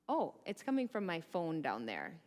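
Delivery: normal talking voice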